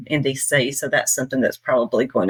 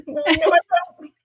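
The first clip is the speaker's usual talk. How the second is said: much higher, slow, laughing protest; laughing denial; 13 semitones higher, slower than usual. Retold or imitated. Denying she has an accent to the host, laughing. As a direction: deny it through giggles. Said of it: "they keep accusing me of having an accent".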